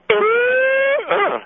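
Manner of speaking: high parrot whistle; long high-pitched parrot trill; imitated parrot reply. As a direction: whistle high like a pet bird, then grunt. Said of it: "And he'll go".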